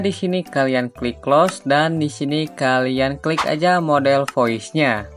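normal talking voice

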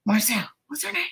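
mock whisper. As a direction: lean in and whisper it like a sly aside. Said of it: "Just be like".

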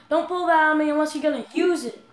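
yelling. Acted. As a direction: bellow the dare at him. Yelling at game character.

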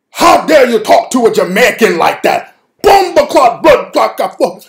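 loud, high Jamaican patois shouting; furious Jamaican rant. Retold or imitated. Rick shouting at the American dudes in Jamaican patois. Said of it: "all that Jamaican shit he was doing".

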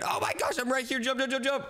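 high, rushed jump jump calls; excited squeals. Real, not acted. Dodging her brother, high excited jump chant. Jumping around the seeker.

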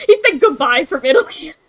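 words broken by laughter; laughing. Real, not acted. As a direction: laugh through the line. Laughing while reporting.

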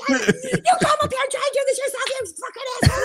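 retold yell. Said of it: "yelled at him".

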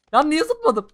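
high loud startled yelp. Real, not acted. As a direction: yelp at your own mistake. Accidental jump under fire.

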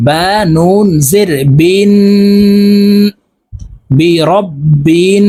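"রব্বিন" held long with a nasal hum on the doubled n; nasal ghunnah. Shown as a demonstration. Teacher shows students the ghunnah on rabbin, slow nasal hold. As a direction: draw out "বিন" nasally through the nose. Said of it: "দেখুন এভাবে পড়তে হবে".

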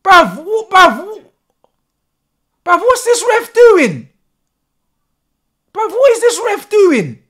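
high, loud, spluttering disbelief; splutter, then yell the question; outraged stammering shout. Real, not acted. Fan lost for words at the ref, outraged shout.

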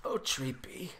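breathless, adoring whisper; lovestruck whisper. Acted. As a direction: whisper her name like a secret. Lover whispers to the plant.

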